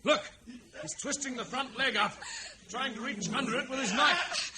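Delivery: high, loud breathless commentary; frantic. Acted. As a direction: rush it out in alarm. Frantic during the fight.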